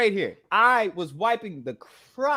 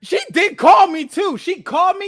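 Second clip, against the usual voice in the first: indignant exclamation; 11 semitones higher, 9 dB louder than usual. Real, not acted. Indignant retort.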